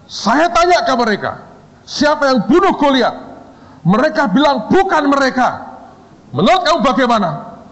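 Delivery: high-pitched, insistent mock questioning; acted interrogator. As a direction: grill the teacher theatrically. Boss questions the lecturer.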